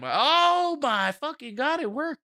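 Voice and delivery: high, slow amazed burst; stunned delight it worked; high-pitched disbelief at the screen; real surprise. Real, not acted. Excited surprise at widget.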